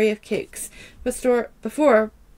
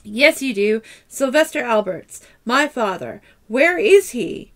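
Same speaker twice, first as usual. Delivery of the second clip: furious demand; 5 dB louder than usual. Acted. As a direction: hammer each word at him. Madison interrogating Otis furiously.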